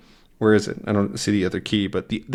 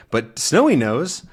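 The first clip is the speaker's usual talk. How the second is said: usual speaking voice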